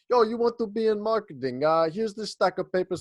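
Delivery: hint of a Russian lady's voice; lightly imitating the brusque advisor; mock Russian advisor. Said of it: "like, Russian lady".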